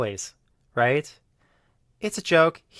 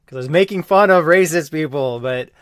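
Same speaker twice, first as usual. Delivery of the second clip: raised, insistent voice; defending himself, louder now; loud and heated; 4 semitones higher, 10 dB louder than usual. Real, not acted. Heated self-defence rant.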